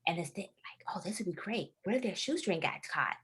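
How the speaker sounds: hushed plotting whisper; whispered character voice. Retold or imitated. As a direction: breathe it like a scheming inner voice. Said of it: "one of my characters like".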